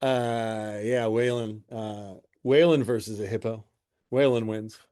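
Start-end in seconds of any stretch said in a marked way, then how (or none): none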